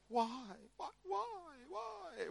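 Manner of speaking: put-on high-pitched, soft, pleading child's whine; imitating a whiny son. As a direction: mimic a clingy kid begging why. Son Jonathan whining at being moved.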